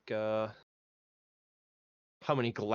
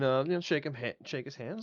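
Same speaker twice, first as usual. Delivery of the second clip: ordinary talk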